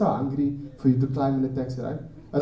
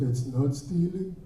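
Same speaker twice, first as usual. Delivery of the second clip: calm denial. Retold or imitated. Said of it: "He's like".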